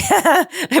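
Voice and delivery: a quick, high laugh that breaks out; high burst of laughter. Real, not acted. Laughing at her own hands on camera, high giggle. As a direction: let a real laugh escape, high and bright.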